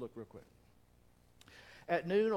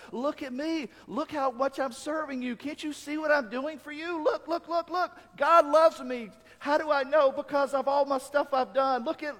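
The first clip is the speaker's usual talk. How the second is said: much higher and louder, a whiny look-at-me plea; mocking religious show-off; 9 semitones higher, 8 dB louder than usual. Preacher mocking religious people who crave credit, high and loud whine. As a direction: ham up a self-righteous showoff begging God for notice. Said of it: "They're like".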